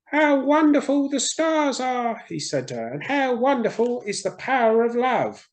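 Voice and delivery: put-on a lover on the balcony speaks to his girl, high and swooning; dreamy lover. A narrator's reading. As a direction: sigh the words out to the stars.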